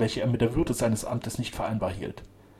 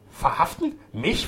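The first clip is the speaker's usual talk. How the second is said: higher, louder incredulous question; outraged disbelief; 6 semitones higher, 5 dB louder, slower than usual. A narrator's reading. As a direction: challenge it with affront. Man outraged at being arrested.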